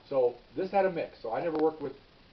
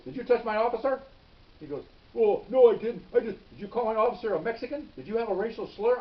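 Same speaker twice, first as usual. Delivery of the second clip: much higher and louder accusing questions; retold angry grilling; 8 semitones higher, 7 dB louder than usual. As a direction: tear into the suspect, imitating. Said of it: "he's all over this guy".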